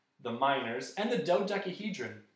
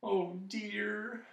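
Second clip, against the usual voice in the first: small, higher, soft dismay; sad and soft; 7 semitones higher, 4 dB quieter than usual. A narrator's reading. Milo dreading dinner, sad and soft. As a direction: say it softly and sorrowfully. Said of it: "said Milo sadly and softly".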